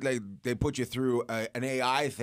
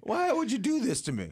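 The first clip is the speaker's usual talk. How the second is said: high mock lament; 13 semitones higher than usual.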